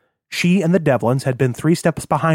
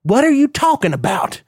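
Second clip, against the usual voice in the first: much higher, frantic protest; alarmed outburst; 12 semitones higher than usual. A narrator's reading. Captive son demanding answers from father, alarmed outburst. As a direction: cry out in bewildered panic.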